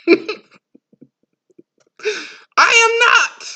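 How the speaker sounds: high, loud laugh through the words; mocking laughter; laughing mockery. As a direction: cackle out the words.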